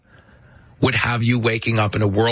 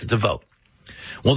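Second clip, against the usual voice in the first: ordinary talk, nothing marked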